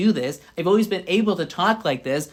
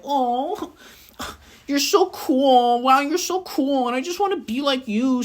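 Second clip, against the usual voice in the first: gushing admiration; 6 semitones higher than usual. Acted. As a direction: swoon over your idol in a higher voice. Fawning over an admired crush.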